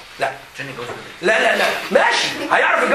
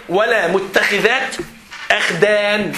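ولا متخذات أخدان drawn out slowly, ولا rising; teacher slowly quotes the verse to the class; slow recitation; much slower than usual. Shown as a demonstration. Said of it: "ربنا قال في القرآن إيه؟".

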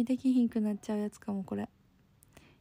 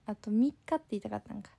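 normal talking voice

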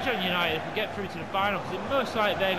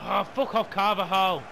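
louder, annoyed outburst; irritated swearing; 3 semitones higher, 7 dB louder than usual. Real, not acted. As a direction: curse the opponent under rising frustration. Swearing at opposing player.

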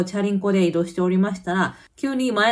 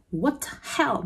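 mock angry retort; 5 semitones higher, 4 dB quieter, much slower than usual. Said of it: "結構怒ったような感じで".

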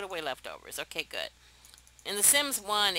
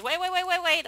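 much higher, rapid repeated calls; quick excited high calls; urgent high wait; 11 semitones higher, 6 dB louder than usual. Real, not acted. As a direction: hurry to stop her. Calling a Sim to stop.

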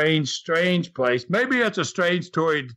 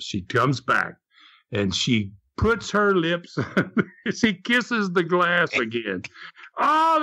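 ordinary talk, nothing marked

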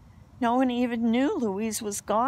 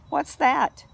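higher, louder quick question; curious question; 7 semitones higher, 5 dB louder than usual. A narrator's reading. Friend asking eagerly what it is. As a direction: ask it brightly.

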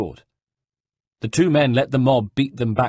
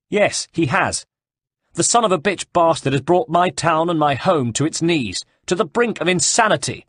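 bitter, raised and higher; exiled duke cursing Savonarola, angry and raised; angry young noble; 8 semitones higher, 4 dB louder than usual. Acted. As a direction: spit it out in resentment. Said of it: "now contorted in anger".

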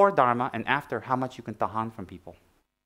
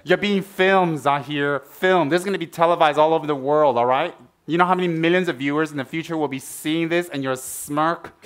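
mock scolding; 4 semitones higher, 6 dB louder than usual. Real, not acted. Teasing student who smirked.

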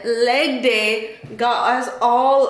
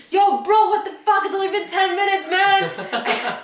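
high-pitched indignant outburst, imitated; mock a panicked, outraged friend; retold freak-out, voice pitched way up; 9 semitones higher than usual. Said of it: "He's just freaking out".

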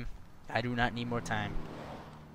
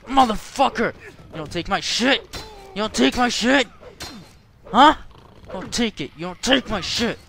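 loud, raised-pitch scolding; angry shouting; 8 semitones higher, 9 dB louder than usual. Real, not acted. Player fighting a character over his stuff, angry shouting. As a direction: yell at a thief, punching the words.

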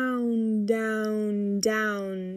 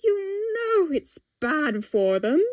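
higher, drawn-out fretful protest; crying out; 6 semitones higher than usual. A narrator's reading. Timothy warning about feeding the fish, protesting. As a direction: protest it slowly, pitched up. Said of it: "Timothy cries suddenly".

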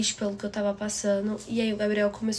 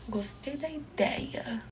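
soft, slow near-whisper; sheepish, drawn-out whisper; hushed confession; 2 semitones higher, 7 dB quieter, much slower than usual. Retold or imitated. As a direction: admit it slowly under your breath. Hushed confession of liking idea.

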